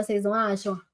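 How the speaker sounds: ordinary talk, nothing marked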